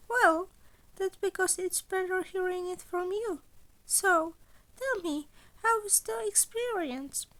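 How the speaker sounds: playful, teasing, eager to hear; high chirpy principal. Acted. Nezu on the phone.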